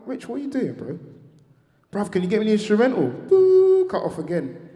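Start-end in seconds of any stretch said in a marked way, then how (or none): 0.1-1.0 higher, louder baffled question; bewildered. Retold or imitated. Speaker's bewildered reply. 1.9-3.1 much louder, pressing repeat of the request; urgent repeated phone plea; insistent plea. Retold or imitated. Rich repeats his plea. 3.1-3.9 loud high imitated phone cutoff; mock call cut sound. Mimics call cutting off.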